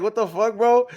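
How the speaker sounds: high, startled what-the-hell; a stunned, high-pitched mock exclamation; retold shocked outburst. Retold shock at strange men.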